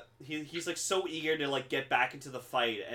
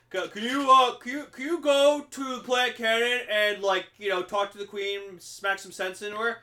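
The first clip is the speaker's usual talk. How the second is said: [name] commanding voice; 7 semitones higher, 5 dB louder than usual. Retold or imitated. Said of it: "this is my Cosmo voice".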